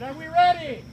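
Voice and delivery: rallying the crowd; hype-up call. Real, not acted. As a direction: pump up the team. Hyping up crowd before challenge.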